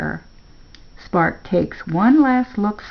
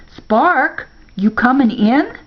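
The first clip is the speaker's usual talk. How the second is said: calling out; 7 semitones higher, 4 dB louder than usual. A narrator's reading. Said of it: "calls to Spark".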